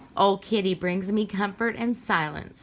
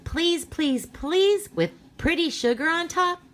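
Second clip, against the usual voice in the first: put-on begging child; 11 semitones higher than usual. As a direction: plead like a little kid. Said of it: "I begged".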